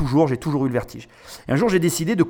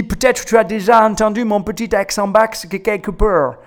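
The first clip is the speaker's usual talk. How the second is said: slow, higher imitation of an English accent in French; mock English accent; 5 semitones higher than usual. Commentator mimics the pitcher's self-mocking joke, in a put-on English accent. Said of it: "c'était un accent anglais".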